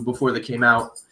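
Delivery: talking while wrestling a dog; struggling with a squirming dog; holding down a pet. Real, not acted. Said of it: "Are you wrestling a dog right now?".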